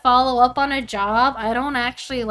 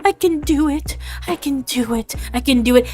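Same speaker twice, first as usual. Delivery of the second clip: whimpering, gasping self pep talk; hammed-up crying while psyching yourself up; mock pre-call panic; 4 semitones higher, 3 dB louder than usual. Streamer acting meme of anxious caller before a call, mock crying. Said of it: "cry before the call".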